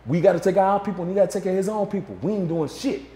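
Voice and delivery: heated mock tirade; Pac ranting. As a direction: imitate Pac stirring things up. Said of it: "Pac was like".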